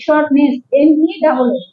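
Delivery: ordinary talk, nothing marked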